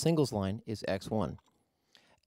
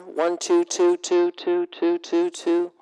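louder, higher, filter shifting; sweeping narrow filter tone; 5 semitones higher, 6 dB louder, slower than usual. Shown as a demonstration. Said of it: "we can vary the filter".

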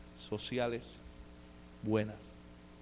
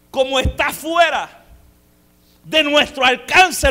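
loud, higher, pounding every phrase; full-force preaching; 9 semitones higher, 21 dB louder than usual. Acted. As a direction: shout the promise over the music.

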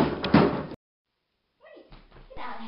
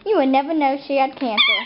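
ordinary delivery

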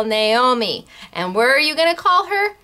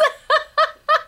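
high giggle. Real, not acted. Laughing at throne idea.

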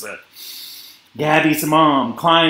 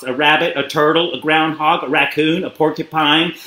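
usual speaking voice

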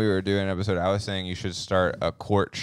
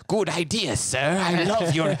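posh British officer; 8 semitones higher, slower than usual. Retold or imitated. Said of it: "British people are like".

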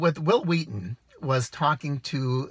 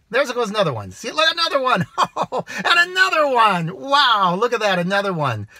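real delight, much higher and louder, spotting deer; thrilled, laughing, pointing out deer; excited; 8 semitones higher, 6 dB louder than usual. Real, not acted. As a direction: exclaim at each deer bounding past. Spotting deer run behind.

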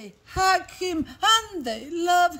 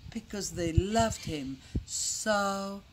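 low, soft, slow close; lingering, very quiet tenderness; tender ending; 7 semitones lower, 9 dB quieter, slower than usual. A narrator's reading. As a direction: let it sink gently to a hush.

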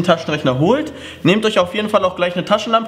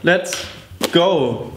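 ordinary delivery

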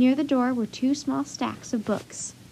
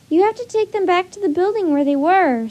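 higher, louder pleading; urgent plea; 7 semitones higher, 6 dB louder than usual. A narrator's reading. Annie begs the driver to turn back, urgent plea. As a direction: plead insistently with raised voice.

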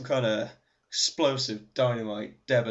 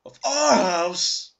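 mock terror; 7 semitones higher, 9 dB louder, much slower than usual. Mock alarm at creepy photos.

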